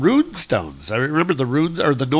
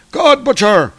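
loud, raised battle challenge; yelling; 4 semitones higher, 6 dB louder than usual. Acted. Young Thor challenging his foe into the open, yelling. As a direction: call him out with a high, loud shout. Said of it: "And he's yelling out".

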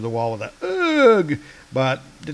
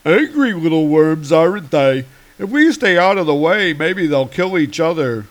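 loud, mocking frost giant; 4 semitones higher, 6 dB louder than usual. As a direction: sneer down at the fighters as a giant. Frost Giants watching the fight.

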